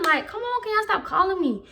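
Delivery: high-pitched, whiny exasperation; exasperated plea. Retold or imitated. Student fed up with being called to the office, exasperated plea. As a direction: plead with the pitch shooting up.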